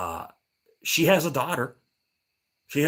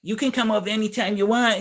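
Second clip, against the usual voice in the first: high put-on inviting voice; imitating old lady; 10 semitones higher, 4 dB louder than usual. Old lady offering neighbor apples, mimicked high. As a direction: mimic her invitation, pitched way up. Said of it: "She was like".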